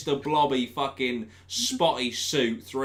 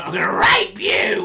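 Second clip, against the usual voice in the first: menacing actor; 7 semitones higher, 13 dB louder than usual. Retold or imitated. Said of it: "they were".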